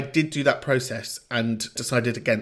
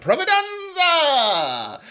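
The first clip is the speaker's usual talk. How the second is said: grand flourish; 5 dB louder, much slower than usual. Pretend. Flourish on the last name.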